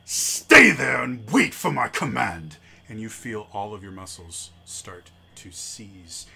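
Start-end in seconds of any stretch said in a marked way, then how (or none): 0.0-2.6 booming slow villain command, much louder; villain commanding the party to halt, booming. Acted. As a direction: order them to stay put, loud and slow. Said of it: "and says".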